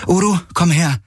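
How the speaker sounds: high, calling order; calling command. A narrator's reading. Kirai calls her mount over, commanding. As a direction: summon the animal over.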